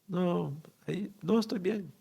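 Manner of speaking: high, halting reply through grief; choked-up recollection. Real, not acted. Recalling a reply to his late brother, choked up. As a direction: voice rising as emotion takes hold.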